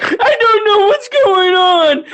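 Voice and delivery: high, slow, wailing bawl; exaggerated tearful wail; mock crying. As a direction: imitate a subordinate breaking down in tears. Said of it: "They would literally start crying".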